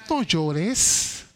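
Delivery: low, soft and tender; tender from the heart. A narrator's reading. Jesus comforting the widow at her son's funeral, low tender voice. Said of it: "esa voz que salió de su corazón".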